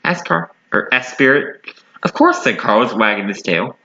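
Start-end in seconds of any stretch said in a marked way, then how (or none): 2.0-2.4 high, bright eager answer. A narrator's reading. Carlos offering Spirit a new home, high eager reply. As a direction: answer with delight.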